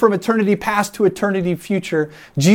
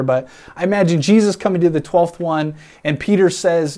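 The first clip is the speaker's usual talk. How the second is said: ordinary talk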